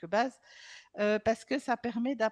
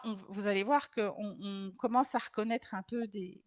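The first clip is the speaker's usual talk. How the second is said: ordinary delivery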